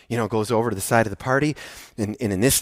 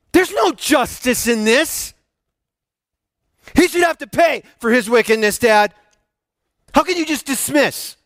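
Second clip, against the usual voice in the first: high, loud, wounded protest; older son arguing with his father; indignant older son; 9 semitones higher, 3 dB louder than usual. Acted. Older son's angry complaint voiced.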